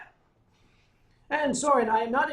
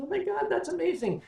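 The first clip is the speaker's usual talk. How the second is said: amazed waitress; 12 semitones higher than usual. Retold or imitated. Waitress amazed at wine guess.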